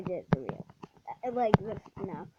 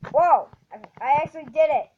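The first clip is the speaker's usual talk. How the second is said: loud, fast burst of joy; excited shout; 3 semitones higher, 10 dB louder than usual. Real, not acted. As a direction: blurt it out, thrilled. Finally lands the jump.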